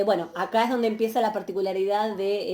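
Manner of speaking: ordinary talk, nothing marked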